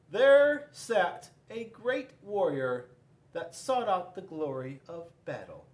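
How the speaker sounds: ordinary delivery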